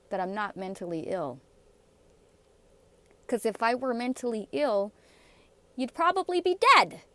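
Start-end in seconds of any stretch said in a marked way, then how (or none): 5.8-7.0 sharp outburst. Real, not acted. Threatening aside.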